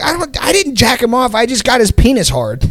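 high, hammed-up kid voice, earnestly correcting; high and matter-of-fact, like it's a fair point; earnest weird kid. The weird kid correcting the boys, in a high, earnest put-on voice.

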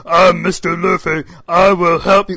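hammed-up bad dub delivery; mocking wooden dub voice. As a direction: say it like a bored voice actor reading a card. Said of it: "cuts to Jinbei".

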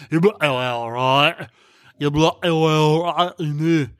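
badly mangled mock British accent; terrible British accent. Husband confusing wife on purpose, mangled British accent. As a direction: garble the words in an awful fake British accent. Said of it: "make my accent even worse".

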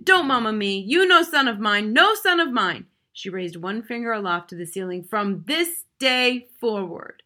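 loud, rising furious declaration; angry disowning. Acted. Mother disowns her son before the family, furious declaration. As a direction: cast him off with finality.

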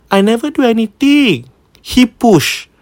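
put-on high little girl's protest; lightly voiced small girl insisting; child's voice. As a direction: squeak it out like a small defiant kid. Child's quoted denial, voiced high.

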